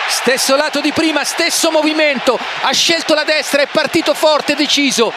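high, lively analysis; excited commentary. Real, not acted. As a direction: talk it through, thrilled. Co-commentator excited after save.

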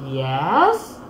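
"Yes" starting low and sweeping up into a question; rising intonation. Retold or imitated. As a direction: lift your voice on "Yes", as if asking. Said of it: "meaning you raise your voice".

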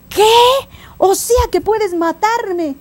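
high, slow horrified question; alarmed lion. Acted. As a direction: gasp it out in acted disbelief. Lion alarmed by the donkey's weapon.

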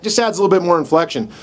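ordinary talk

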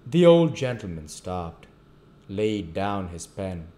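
usual speaking voice

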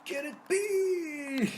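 drawn-out, very high yell of Gerrit Bale; huge goal yell. Real, not acted. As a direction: scream the scorer's name in triumph. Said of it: "Dayı ne bağırdım böyle". (gol sevinciyle uzun, tiz bir haykırış)

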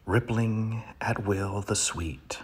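dramatic poetry reading with sudden swells; poem reading. Acted. Comic reader performing a poem to his audience, hammed up. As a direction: reads the duck poem with grand pauses.

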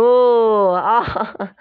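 loud high burst of laughter; laughing. Real, not acted. Girls delighted at the snake, loud laugh.

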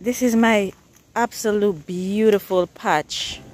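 ordinary talk

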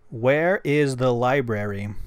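loud, slow question for the translator; clear dictation into phone. Shown as a demonstration. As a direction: ask it slowly and clearly for the app. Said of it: "Here we go".

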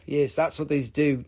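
ordinary delivery

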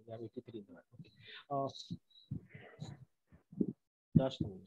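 faint voice, far from the mic; very low volume. Real, not acted. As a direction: speak much too softly into the mic. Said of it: "Your voice is very low".

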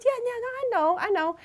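high put-on voice of a backpedaling boss; imitating the manager. Retelling the manager's reply, a high put-on backpedaling voice. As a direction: play him quickly covering his tracks.

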